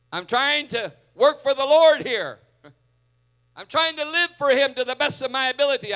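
loud, high, exasperated Martha demanding help; hammed-up frantic plea to be sent help; Martha's demand. Martha demanding Jesus send her sister to the kitchen, loud and exasperated. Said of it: "she says a command to him".

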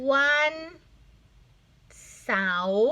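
วัน held, long pause, then stretched เสาร์; syllable-by-syllable reading. Shown as a demonstration. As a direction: sound out วันเสาร์ in two beats. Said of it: "อันนี้".

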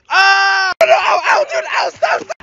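high, shouted yelps; pained yelping. Acted. Begging the bully to stop throwing, pained yelps. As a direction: cry out under a pelting. Hit during catch game.

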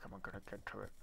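whisper. Real, not acted. Frustrated gamer stuck below ore.

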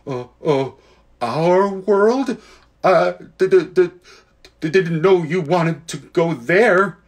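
put-on flustered, stuttering boy, slow; stammering. Diggory, caught out by the Queen, stammers nervously. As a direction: stumble over the d's in a panic. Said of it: "got very red in the face and stammered".

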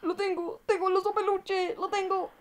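high, giddy cry with a laugh; excited. Real, not acted. Streamer cheering to chat over the teddy, high and laughing. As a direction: burst out in delight at the prize. Finally gets the teddy bear.

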